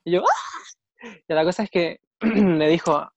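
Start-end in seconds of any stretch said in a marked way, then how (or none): 0.2-1.1 high-pitched retold "ah" of delight; swooning squeal. Retelling how the crush started talking, high overwhelmed squeal. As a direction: let out a high mock-overwhelmed gasp.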